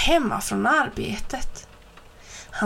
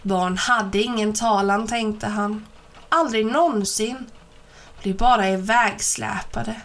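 plain reading voice

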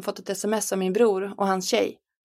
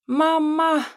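put-on high, loud child's cry of "Mamma!"; desperate scream; 8 semitones higher, 4 dB louder than usual. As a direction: scream for mom in despair. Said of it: "skrek Lollo". (förtvivlat skrik efter mamma)

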